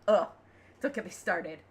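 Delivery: normal talking voice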